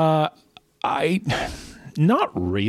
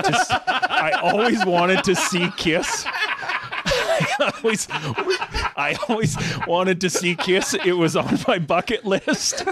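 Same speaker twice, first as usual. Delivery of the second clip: laughing; 7 semitones higher than usual. Real, not acted. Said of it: "laughing while confessing".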